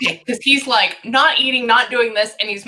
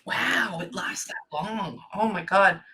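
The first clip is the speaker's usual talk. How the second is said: real breathless whisper, much softer; stunned whisper; 7 dB quieter, slower than usual. Real, not acted. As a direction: whisper it in quiet shock. Stunned by two years illness.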